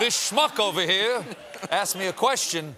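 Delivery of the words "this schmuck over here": slow, hammed-up comedian impression; Jackie Mason. Host playing the president as a comic, hammed-up voice. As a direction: lean into the old comic's cadence, drawn out. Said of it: "that Jackie Mason voice".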